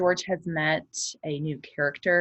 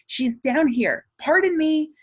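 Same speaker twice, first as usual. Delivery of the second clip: aunt calling out higher and louder; 12 semitones higher, 6 dB louder than usual. Acted. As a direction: call across the gate to the steward. Aunt calls to the steward.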